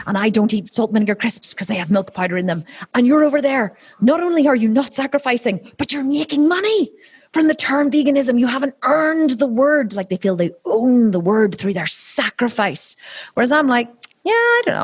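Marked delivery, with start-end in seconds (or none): 0.1-9.9 mock indignant vegan. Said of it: "the reason why they're so angry". 14.2-14.8 airy mock shrug. Shrugging off angry vegans.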